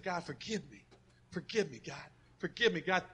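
quiet plea for forgiveness; soft repentant prayer. Retold or imitated. Pastor retelling his driveway prayer, soft and repentant. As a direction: murmur it as a humbled confession.

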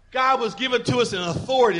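ordinary talk

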